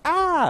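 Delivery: loud, very high fake yelp; hammed-up frightened cry; mock scream. Shown as a demonstration. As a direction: shriek in pretend fright. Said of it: "the dog barks and I go".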